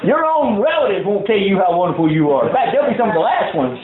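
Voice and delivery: rally the congregation; preaching at full force. Acted. Pastor urging his church to stand firm, preaching.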